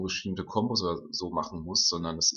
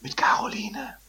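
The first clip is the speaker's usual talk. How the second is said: hushed whispered intro; whispering. Acted. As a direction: whisper it like a secret. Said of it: "das Intro diesmal in flüsternder Form".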